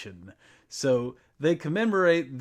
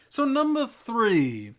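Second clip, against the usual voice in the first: higher, louder, slow buildup; excited announcing; 6 semitones higher, 6 dB louder, slower than usual. Acted. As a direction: announce it with fanfare. Host announcing next countdown item.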